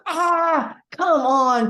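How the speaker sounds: a high, loud, whiny come on; mock-pained outcry of disbelief; exasperated disbelief. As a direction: protest like she's kidding you. Incredulous protest at friend.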